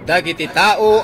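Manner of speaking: ordinary talk, nothing marked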